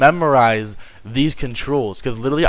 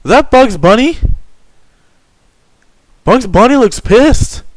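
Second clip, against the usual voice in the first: nervous excitement; 9 semitones higher, 5 dB louder than usual. Real, not acted. Staring at scary Bugs Bunny.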